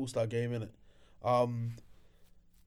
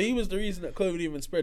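normal talking voice

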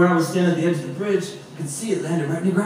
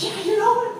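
very high, eager affirmation; mock eager reply. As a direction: imitate her jumping at the offer. Acted woman's eager reply.

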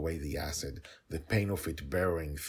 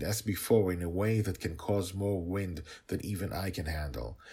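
plain reading voice

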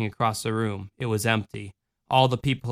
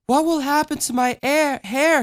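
put-on sleepy little girl; 12 semitones higher than usual. A narrator's reading. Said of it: "she asked sleepily".

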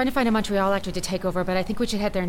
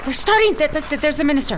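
higher and louder, hurried pointing out; urgent excitement; 6 semitones higher, 6 dB louder than usual. Acted. Angela urging Mark as the ceremony starts, excited. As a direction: rush him, it's starting now.

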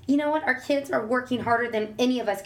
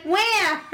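high, loud imitated wah; mock baby cry; 9 semitones higher, 5 dB louder than usual. Son answering with a baby cry, high and loud. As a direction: wail like a baby, playfully.

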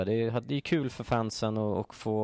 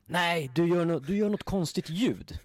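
higher, put-on voice of the plainclothes guard; imitating guard; 8 semitones higher, 3 dB louder than usual. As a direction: mimic the bossy guard, pitched up. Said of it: "frågar han mig så här". (härmar vakten med ljus röst)

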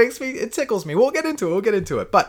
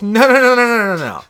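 loud refusal; 6 semitones higher, 6 dB louder than usual. Retold or imitated. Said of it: "And he goes".

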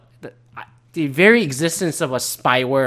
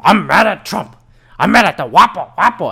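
hammed-up ranting Alex Jones, higher and much louder; 7 semitones higher, 9 dB louder than usual. Podcaster mocks a conspiracy host mispronouncing names, loud ranting parody. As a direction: bellow it like a parody of a furious radio host. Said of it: "If Alex Jones cannot pronounce Huawei".

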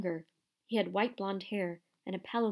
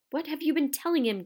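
higher, louder angry demand; angry growl; 7 semitones higher, 5 dB louder than usual. Acted. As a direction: snarl the question as a threat. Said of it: "growled Bane".

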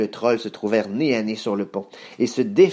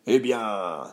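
drawn-out troll growl; troll, menacing. Acted. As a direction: growl it as a looming monster. Voices the troll.

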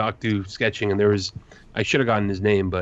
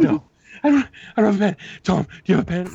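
much higher and louder, panicky search; frantic squeal; 11 semitones higher, 4 dB louder, faster than usual. Retold or imitated. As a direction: squeal it out like you are desperate.

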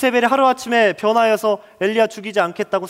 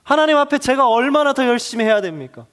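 anguished protest; 5 semitones higher than usual. Acted. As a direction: pour out the frustration of a prophet who has done enough. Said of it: "일상의 언어로 해석해 보면 이렇습니다".

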